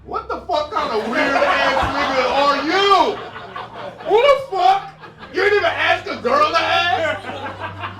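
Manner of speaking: high, loud, drawn-out indignation; comic outrage. Real, not acted. Comedian berates audience member for question, loud incredulous outrage. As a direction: shout in disbelief at a rude question.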